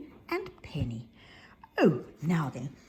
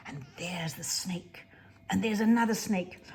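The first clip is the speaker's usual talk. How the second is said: ordinary delivery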